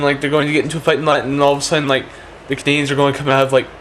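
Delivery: normal talking voice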